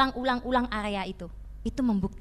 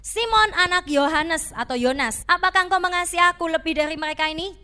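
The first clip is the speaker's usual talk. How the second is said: voice of Jesus; 5 semitones higher, 7 dB louder than usual. Acted. Jesus questioning Peter.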